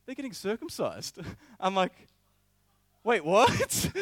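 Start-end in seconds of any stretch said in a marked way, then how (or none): 0.1-1.2 high-pitched, amused imitation; almost laughing. Charles explaining the procession to leader, almost laughing. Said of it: "he's, like, almost laughing". 3.0-3.9 loud, high incredulous yelp; shocked disbelief. Retold or imitated. Leader reacting to the surprising news, shocked. Stunned reply to circumcision news.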